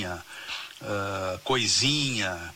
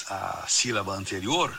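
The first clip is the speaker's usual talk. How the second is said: ordinary talk, nothing marked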